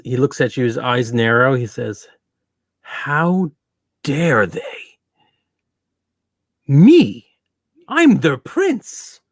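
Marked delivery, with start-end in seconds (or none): 2.8-9.2 higher, louder, stung outrage; outraged prince. Acted. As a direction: rise up, offended royalty. Offended prince outraged in character.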